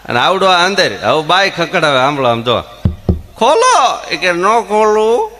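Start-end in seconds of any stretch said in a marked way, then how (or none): 3.4-4.1 high, loud call to open the door; pleading call. Retold or imitated. Wife locked out, calling to open the door, high and loud. 4.3-5.4 teasing refusal. Retold or imitated. Husband teases from inside.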